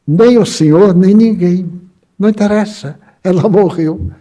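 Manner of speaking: acted insistence, lifted voice; firm refusal. Retold firm refusal to the doctor.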